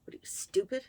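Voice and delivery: put-on bitter whisper of the old insult. Recalling her mother's insult to the listener, in a bitter whisper. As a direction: breathe the words like a memory that stings.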